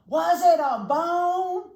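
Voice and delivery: very high, very loud slow holler. A narrator's reading. Dog hollering for the bird, very loud. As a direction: holler it up into the tree. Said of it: "Rocket hollered".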